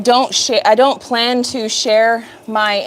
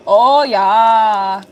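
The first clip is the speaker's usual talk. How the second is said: playful mock German accent; drawn-out "Oh, yeah" with a German accent; best German accent; 3 dB louder than usual. Streamer savoring the satisfying job, in a mock German accent. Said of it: "In my best German accent".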